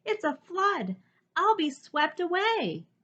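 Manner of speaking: high, alarmed voice; frightened cry. Acted. Jubal crying out in fear of the rising flood, high and alarmed. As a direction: panic about being swept away. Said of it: "he cried".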